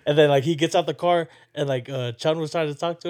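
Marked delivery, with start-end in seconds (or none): none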